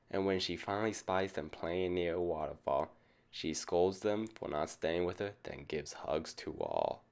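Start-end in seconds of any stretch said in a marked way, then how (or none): none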